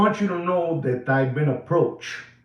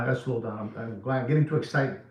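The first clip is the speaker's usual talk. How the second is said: reining himself in; 6 semitones lower, 6 dB quieter, faster than usual. Real, not acted. Host catching himself getting too excited, calming down. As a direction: lower and softer, calming down.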